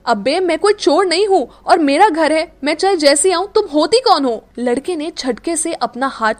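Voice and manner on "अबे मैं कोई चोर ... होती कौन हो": loud, higher indignant protest; furious at being called a thief; angry retort. Acted. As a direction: push back hard at her. Boy insists it is his house.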